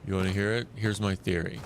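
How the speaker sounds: ordinary talk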